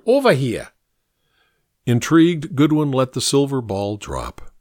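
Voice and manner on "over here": higher-pitched call to come over; mildly shouted beckoning; shouted summons. A narrator's reading. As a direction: call someone across the room. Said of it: "he shouted".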